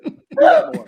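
high squeal through laughter; laughing. Real, not acted. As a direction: laugh while egging them on. Laughing encouragement.

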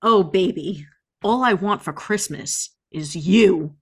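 ordinary delivery

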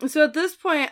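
normal talking voice

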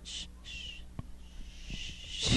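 hushed shush; whisper. Real, not acted. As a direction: whisper it to hush them. Hushing the other speaker.

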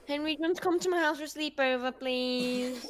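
high, fast, pleading sleepover ask; begging a friend for a sleepover; pleading little kid. Retold or imitated. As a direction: beg sweetly like a little kid. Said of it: "I'll be like".

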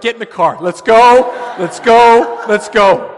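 loud, high hurry-up command; urgent dad shout. Retold or imitated. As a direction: rush the kids out the door. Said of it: "I was like".

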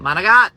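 manigat, mana-GOT, ending clipped off; Italian-American pronunciation. Shown as a demonstration. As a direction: punch the GAT of manigat, loudly. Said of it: "not manicotti".

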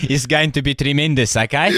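Afrikaans Trump impression. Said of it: "Afrikaans Donald Trump".

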